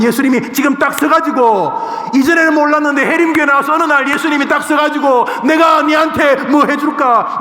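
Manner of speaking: very high, loud sustained cry; excited shouting. Acted. Preacher acts out Jesus stopping to offer help, shouting excitedly. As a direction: shout it out at full pitch, building.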